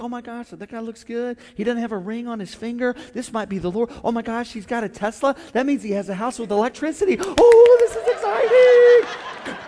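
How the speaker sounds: high, hushed then squealing with delight; mock swooning woman. Woman spotting a man at Growth Track, hushed then squealing. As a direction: gush over him, then squeal. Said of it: "you go and look".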